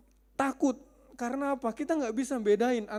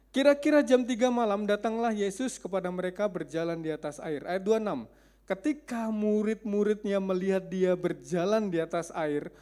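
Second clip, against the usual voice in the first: usual speaking voice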